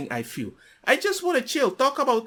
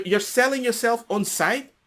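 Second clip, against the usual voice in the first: normal talking voice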